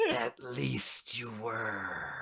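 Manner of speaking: soft, very slow, breathy villain voice; alchemist villain. Acted. Alchemist taunts Bryn, slow hushed menace. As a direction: let the words trail out slowly and softly.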